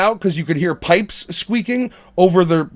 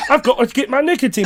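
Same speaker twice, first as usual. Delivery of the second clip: higher mock English accent, chewing gum; hammed-up gum-chewing English actor; Michael Caine impression; 5 semitones higher, faster than usual. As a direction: talk round gum in the jaw. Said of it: "there's a piece of nicotine gum in his jaw".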